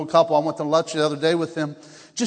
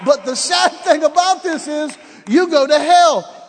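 high, loud preacher's warning, slowing at the end; retold loud warning; 8 semitones higher, 8 dB louder, slower than usual. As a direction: build to a drawn-out shout at the sinner. Shouts warning at robber in story.

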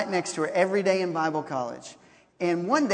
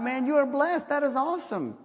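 excited praise; 6 semitones higher than usual. Retold or imitated. Cheering friend.

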